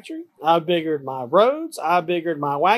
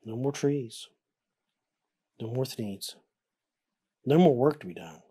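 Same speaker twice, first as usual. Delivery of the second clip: somber; 6 semitones lower, 5 dB quieter than usual. A narrator's reading. Somber end.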